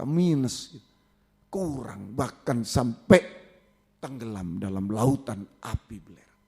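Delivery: put-on fervent exhortation to young people; preacher exhorting young listeners; preaching. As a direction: urge the youth with sermon intensity. Exhortation to youth.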